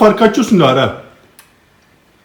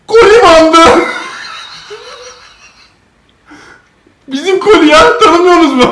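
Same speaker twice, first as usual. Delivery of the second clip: higher and louder, gleeful; excited punchline; 12 semitones higher, 5 dB louder than usual. Acted. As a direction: deliver the joke with glee.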